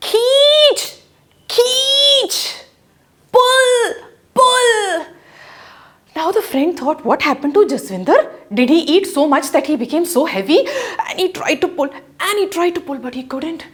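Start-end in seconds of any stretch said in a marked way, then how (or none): 0.1-5.1 high, loud cries of pull; shouting for help. Acted. Head stuck in pot, he yells to his friend, loud high shout. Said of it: "he started shouting to his friend". 7.1-10.6 puzzled fast thought. Acted. Friend puzzled while pulling.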